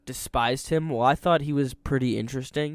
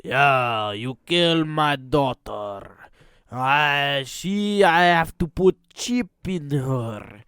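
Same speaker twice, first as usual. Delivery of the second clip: put-on Russian accent; 2 semitones higher, 4 dB louder, slower than usual. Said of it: "this guy was just like".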